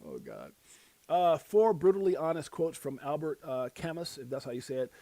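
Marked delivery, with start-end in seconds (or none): none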